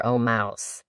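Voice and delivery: dropped low and soft, trailing off; soft address. Acted. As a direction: murmur the mouse's name as an afterthought. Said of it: "the right way of speaking to a mouse".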